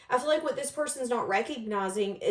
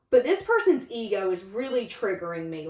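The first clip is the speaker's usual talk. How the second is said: ordinary talk, nothing marked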